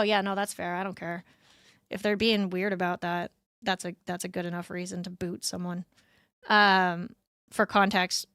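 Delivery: ordinary talk, nothing marked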